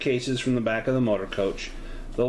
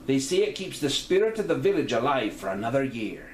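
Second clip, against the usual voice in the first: a hint of Celtic lilt, proud; lightly lilted landlady; 3 semitones higher than usual. GM voicing May.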